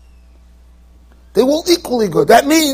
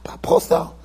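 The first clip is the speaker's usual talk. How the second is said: hushed whisper; 13 semitones higher, 8 dB quieter, slower than usual. Real, not acted. Preacher stresses his disgust.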